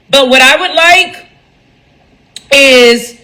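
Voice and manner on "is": usual speaking voice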